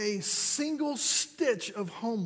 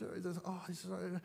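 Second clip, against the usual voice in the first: normal talking voice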